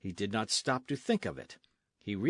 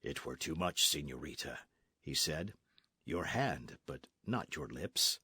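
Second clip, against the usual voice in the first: lower, softer restrained voice; gallant outlaw, gentle; 7 semitones lower, 6 dB quieter than usual. Acted. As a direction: refuse her kindly, holding back. Zorro declines.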